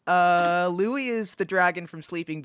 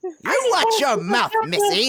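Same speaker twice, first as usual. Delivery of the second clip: stern dad scolding; 10 semitones higher, 3 dB louder than usual. Acted. Dad scolding daughter in roleplay.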